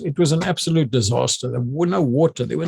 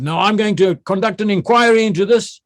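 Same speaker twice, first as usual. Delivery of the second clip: higher, forceful official warning; stern announcement; 9 semitones higher, 4 dB louder than usual. Retold or imitated. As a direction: lay down the law. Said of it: "I got to be melodramatic now".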